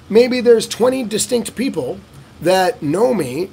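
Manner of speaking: usual speaking voice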